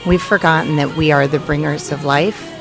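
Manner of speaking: normal talking voice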